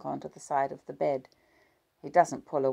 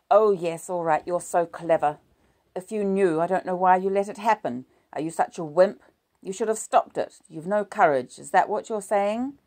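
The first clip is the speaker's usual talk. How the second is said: 1 bitter sarcastic attack; 4 semitones higher, 8 dB louder than usual. A narrator's reading. Wife's bitter quarrel with husband.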